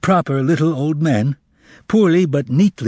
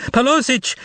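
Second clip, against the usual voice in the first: high falling cry for help; desperate cry; 12 semitones higher than usual. Acted. Salesgirl calling again for the manager, high and desperate. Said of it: "another desperate cry".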